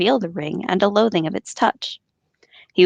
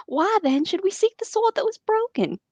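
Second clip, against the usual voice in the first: put-on terrible southern accent; 11 semitones higher, 4 dB quieter than usual. Boromir's line read in an overdone Southern accent. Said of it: "I have a terrible southern accent".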